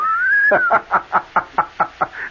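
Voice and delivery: a high burst of laughter. Real, not acted. Remembering the double date with Betty, a high laugh. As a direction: let a laugh break out high.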